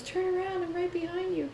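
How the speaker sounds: put-on high, soft child's voice; creepy little kid voice. Wife's prank speaker luring the husband, high soft kid voice. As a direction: lure him to turn around like a ghost kid. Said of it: "this little kid voice".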